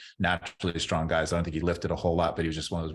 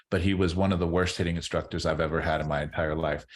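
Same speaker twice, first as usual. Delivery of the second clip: normal talking voice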